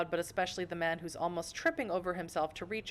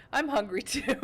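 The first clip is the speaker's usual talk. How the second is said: high, loud, breaking into laughter; real giggly outburst; laughing; 8 semitones higher, 5 dB louder than usual. Real, not acted. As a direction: say it on the edge of a laugh, then burst. Jokes, then bursts out laughing.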